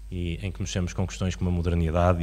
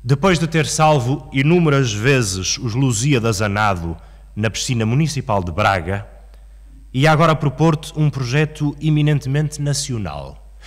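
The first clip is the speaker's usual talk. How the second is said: poem recitation; 2 semitones higher than usual. Acted. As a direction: declaim it as a poem, with long dramatic pauses.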